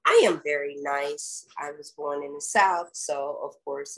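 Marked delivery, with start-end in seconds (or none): none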